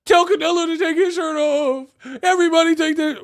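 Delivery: high, loud mock voice; squeaky parody of a fan; mocking whiny fan. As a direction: whine it like a hurt fan. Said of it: "They like".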